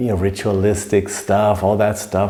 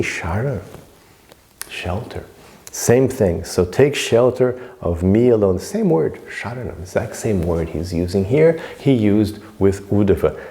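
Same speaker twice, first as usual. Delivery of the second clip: normal talking voice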